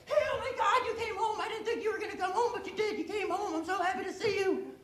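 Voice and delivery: high, breathless overjoyed doggy voice through laughter. Pretend. Dog welcoming its owner home from work, high and gushing. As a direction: gush like a dog whose owner came home.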